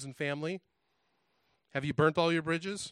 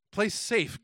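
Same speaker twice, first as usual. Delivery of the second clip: higher-pitched exclamation within a verse reading; storybook cry; 6 semitones higher, 4 dB louder than usual. A narrator's reading. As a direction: cry it out lightly, as the rhyme's character. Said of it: "cried the Zode".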